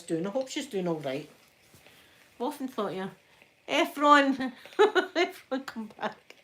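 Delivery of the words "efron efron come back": loud call with a laugh in it; laughing shout of a name. Real, not acted. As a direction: call after him, higher and louder. Calling after user who left.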